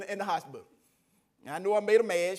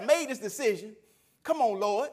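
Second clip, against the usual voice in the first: louder, higher protest to God; retold frustrated plea; 4 semitones higher, 7 dB louder than usual. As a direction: complain to the Lord, exasperated. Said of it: "I'm mad at the decision".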